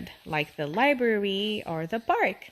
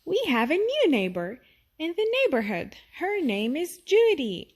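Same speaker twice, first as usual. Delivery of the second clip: put-on higher, eager child voice; child announcing; 6 semitones higher than usual. A child tells everyone about the new neighbor Judy, eager. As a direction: tell everyone the news, bright.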